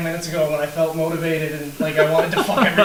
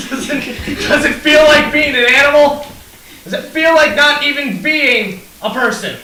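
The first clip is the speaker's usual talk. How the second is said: much louder, higher shouting with a laugh; mock-earnest shouting at the mob; shouted question; 9 semitones higher, 8 dB louder than usual. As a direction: yell the questions over the crowd. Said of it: "shout out as a question".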